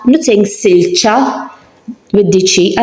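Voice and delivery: usual speaking voice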